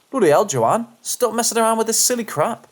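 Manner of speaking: put-on South African accent. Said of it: "with a thick South African accent, shouts something like".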